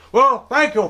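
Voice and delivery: "Well, thank you" leaps high and loud, falling at the end. Acted. Host's sign-off repeated.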